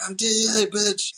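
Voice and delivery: high, loud hammed-up shout; mock yell. DEA agent shouting at the convicts. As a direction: bark it like a cornered agent.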